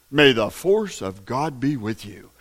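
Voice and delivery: mock a hollow spiritual slogan; mock mystic blessing. Mocking vague spiritual saying.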